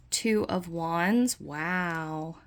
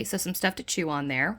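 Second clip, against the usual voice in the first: ordinary delivery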